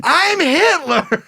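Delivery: high, slow, laughing through it; laughing mock yell. Mocking [name] to the cohost, laughing mock yell. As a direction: crack up as you shout it.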